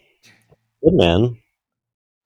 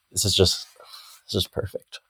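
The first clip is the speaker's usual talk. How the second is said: sleepy raspy whisper, barely voiced; 9 dB quieter than usual. Real, not acted. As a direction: breathe it out half asleep, whispering. Sleepy guest about to nap.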